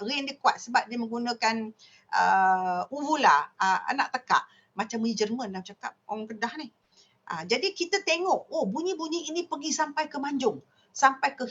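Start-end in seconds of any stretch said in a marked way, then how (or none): none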